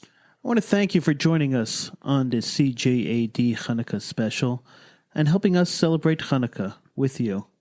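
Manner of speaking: normal talking voice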